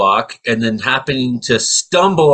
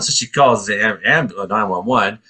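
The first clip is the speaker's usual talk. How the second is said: usual speaking voice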